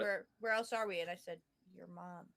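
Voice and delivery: quiet. Retold or imitated. Retelling her quiet joke.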